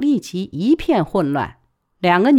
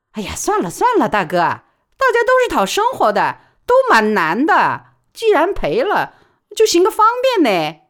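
high, loud placating appeal; coaxing plea; 10 semitones higher, 4 dB louder than usual. Acted. Baoli coaxing the thug to let it go, high and placating. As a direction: talk the big brother down. Pleading with the thugs.

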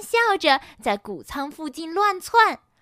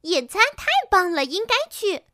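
high, loud, quick exclamation; enthusiastic; 7 semitones higher, 5 dB louder than usual. A narrator's reading. Pinky hears about the picnic, enthusiastic. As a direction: gush with approval.

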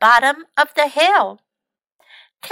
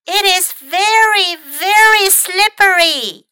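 loud, high yell; 8 semitones higher, 5 dB louder than usual. Acted. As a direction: shout the warning down the hill. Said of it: "yelled Mac".

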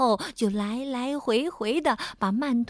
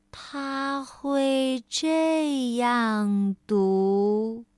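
very slow reading; much slower than usual. Shown as a demonstration. Narrator showing how Mr. Slow reads, very slowly. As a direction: drag each word out very slowly.

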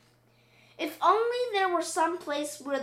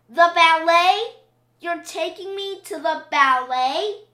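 higher, much louder, thrilled; delighted cry; 5 semitones higher, 11 dB louder than usual. Acted. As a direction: cry out in happy disbelief. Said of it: "Olivia cried out".

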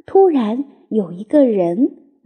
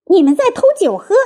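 high, rapid squeal of accusation; rat's shriek; 7 semitones higher, much faster than usual. A narrator's reading. Rat catching the animals stealing cider, shrill shriek. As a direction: squeal it fast and indignant. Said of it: "老鼠尖叫道".

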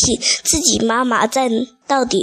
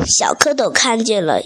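ordinary delivery

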